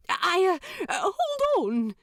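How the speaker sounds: high, flustered stammering angel. A narrator's reading. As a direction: stumble over it, caught off guard. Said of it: "says Aziraphale, gaping".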